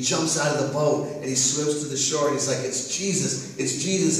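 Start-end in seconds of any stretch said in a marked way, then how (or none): none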